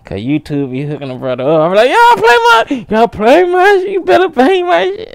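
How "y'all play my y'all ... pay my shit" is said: high, loud, hammed-up yelling; mock demanding shout. Creator pretending to demand plays, mock shouting. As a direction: holler it as an over-the-top demand. Imagined demand to fellow viewers.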